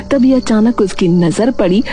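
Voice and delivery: usual speaking voice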